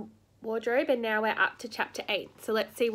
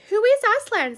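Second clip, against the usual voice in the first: much higher and louder wondering question; eager Susan; 11 semitones higher, 8 dB louder than usual. A narrator's reading. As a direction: ask with sudden wonder. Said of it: "said Susan".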